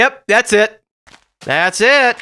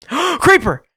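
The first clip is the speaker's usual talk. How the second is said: gasping, high alarmed "Creeper!"; sudden fright at a monster; 11 semitones higher, 4 dB louder than usual. Real, not acted. Creeper appears.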